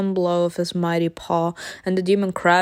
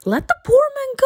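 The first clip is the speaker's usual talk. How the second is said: high, fast, loud protest; indignant shout; 4 dB louder, faster than usual. Real, not acted. Player shouts at the archangels for Lucifer, fast and high. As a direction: yell at them to back off.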